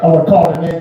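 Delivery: normal talking voice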